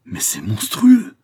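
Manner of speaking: slow, loud outraged outburst; spluttering anger. A narrator's reading. Marquis appalled by his son's marriage plan, furious. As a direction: drag it out, swelling into outrage. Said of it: "bégayant de colère".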